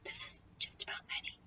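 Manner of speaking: hushed, quick whisper. Acted. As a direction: whisper it firmly to the cards. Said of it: "whisper at them and say".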